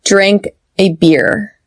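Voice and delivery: slow contrast of "bear" and "beer"; slow model sentence. Shown as a demonstration. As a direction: say it slowly, open "bear", tense "beer". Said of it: "Repeat after me".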